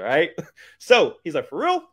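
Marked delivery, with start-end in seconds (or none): 1.5-1.8 high, surprised slangy "Real? Word?"; in character as Brandon. Retold or imitated. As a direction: pitch it up like an amazed street-talking buddy. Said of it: "look how get in character".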